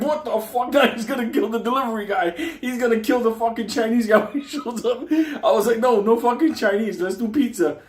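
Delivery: high, loud frantic disbelief; hammed-up panic; panicked. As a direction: freak out, laughing. Panicked reaction to Chinese food.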